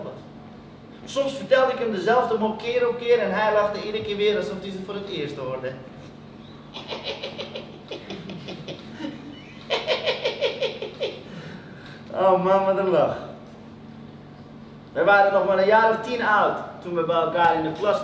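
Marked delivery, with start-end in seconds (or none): 5.8-12.1 high, quiet wheezy giggle; imitated infectious giggle. Friend recalling Ali hearing the same joke, infectious giggle. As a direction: giggle helplessly, high and hushed.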